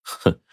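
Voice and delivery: soft contemptuous humph through the nose; scornful snort. A narrator's reading. Chief scoffing at the begging family, soft snort. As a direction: scoff at their plea. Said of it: "就哼了一声".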